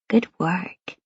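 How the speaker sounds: put-on soft whisper over a sleeping child. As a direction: hush it so she stays asleep. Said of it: "he whispers as he sees her asleep".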